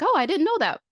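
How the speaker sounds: high, loud surprised realization; a touch of hammed-up shock; mock surprise. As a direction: act like it just dawned on you. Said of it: "And I was like".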